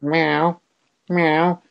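high meow rising at the end; imitated meow. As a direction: meow like a demanding cat. Imitating cat meowing.

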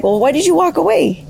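high-pitched retold complaint; puzzled questioning. Dad finding grandma in her room, asking baffled.

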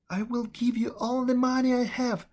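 put-on a frightened girl pouring out her guilt; pleading servant girl. Servant girl's voice.

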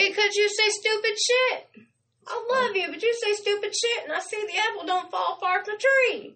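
very high-pitched playful rant; exasperated scolding. Real, not acted. Host tells co-host why she gets upset, exasperated. Snapping back at co-host's complaint.

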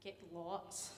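low, soft, weary mumble; mock lazy teacher. Lazy teacher to Jack, low and weary. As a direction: grumble it without effort.

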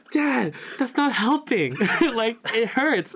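high, loud, slow pleading whine with a laugh; whiny protest. Retold or imitated. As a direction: beg your dad to stop, half laughing. Teen begging dad, both parents insisting.

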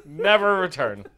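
lightly acted furious expulsion, starting high and loud; mock outrage. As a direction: throw someone out in a retold rage. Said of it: "And they went".

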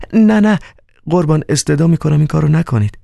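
high pleading cry, then fast. Acted. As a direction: cry out no to stop him. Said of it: "مارگاریتا فریاد زد". (فریاد بلند و ملتمسانه)

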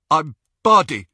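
talking through blood; thick bloodied speech; mouth full of blood. Acted. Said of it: "his mouth was full of blood".

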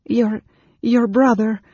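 high, halting, flustered voice; confused. A narrator's reading. Anne Beryl telling Caramon about his brother, confused and halting. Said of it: "Anne Beryl said in confusion".

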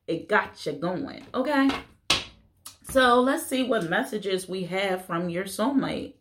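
ordinary talk, nothing marked

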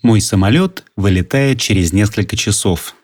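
normal talking voice

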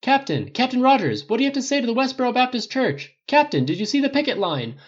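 high, loud shouted questions; urgent pack-of-reporters holler; clamoring reporters. A narrator's reading. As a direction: shout over a press scrum for attention. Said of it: "The reporters clamored as one".

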